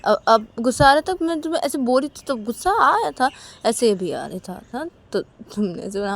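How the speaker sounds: explaining Uma. Acted. Uma explaining her laughter to Sanju, mildly insistent.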